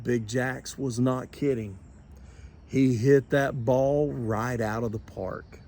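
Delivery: ordinary delivery, nothing marked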